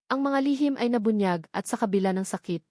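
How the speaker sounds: ordinary delivery, nothing marked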